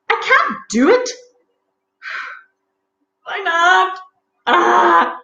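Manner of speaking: high, loud, whining protest; petulant high outburst ending in a cry; fairy tantrum. Acted. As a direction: throw a little royal tantrum. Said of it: "had a tantrum".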